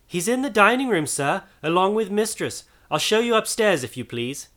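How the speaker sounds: high, polite maid's voice; reply as an obliging maid, pitched high; servant girl. A narrator's reading. Servant girl answers Scrooge.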